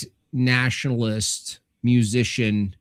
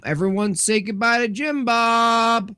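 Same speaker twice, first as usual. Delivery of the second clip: playful send-off; 11 semitones higher than usual. Pretend. Streamer asking everyone to say goodbye, playful high call. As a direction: sing out the goodbye, pitched well up.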